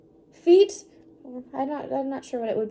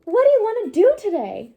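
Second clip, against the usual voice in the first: higher, louder friendly call; cheerful Piggy; 8 semitones higher, 8 dB louder than usual. Acted. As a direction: call out to your friend brightly. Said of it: "how to change my voice".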